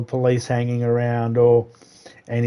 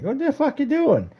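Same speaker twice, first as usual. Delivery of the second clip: high-pitched incredulous demand; retold indignant challenge; 13 semitones higher than usual. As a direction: re-enact catching someone out, voice shooting up. Confronting brother's gossiping neighbour.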